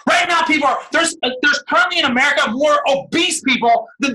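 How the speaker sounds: rally the team like a revival preacher; fired-up sales preaching. Acted. Coach firing up the team on a call, shouting preacher energy. Hyped motivational pitch on call.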